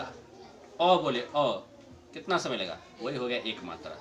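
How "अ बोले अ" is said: अ said very slowly and held, one measured beat; slow vowel demo. Shown as a demonstration. Teacher shows the class the length of अ, slowly. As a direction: draw out अ slowly as a timing example. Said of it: "अ के बोलने में जितना समय लगा".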